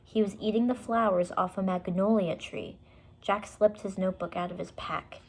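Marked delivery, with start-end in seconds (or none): none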